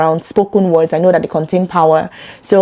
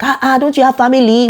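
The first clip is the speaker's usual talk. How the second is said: mock outsider reproach; 6 semitones higher, faster than usual. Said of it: "someone outside can look and be like".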